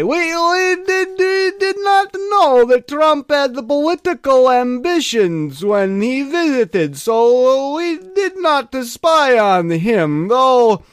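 put-on Russian accent. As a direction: drawl it like a Russian official. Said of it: "What did they say?".

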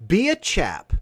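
a lightly voiced British gent urging viewers; mock British accent. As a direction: playfully ham up a British "be a chap". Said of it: "I was gonna talk about accents".

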